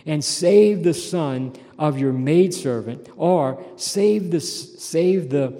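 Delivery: slow, loud earnest plea; fervent psalm plea; pleading as David. Acted. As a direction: implore God for strength. Preacher voicing David's plea.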